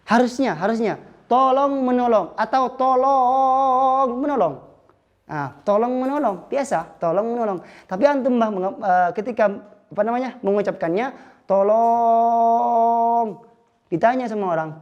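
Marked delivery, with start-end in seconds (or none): 1.3-4.6 "Tolong menolong" with the O sounds stretched, higher; drawn-out O. Shown as a demonstration. Teacher demonstrates long O vowels, higher. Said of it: "itu O-nya banyak nggak". 11.4-13.4 "Tolong." with the O stretched long, louder; long cry for help. Shown as a demonstration. Crash victim calling for help, stretched and louder. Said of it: "ada sebab antum memanjangkan lafal".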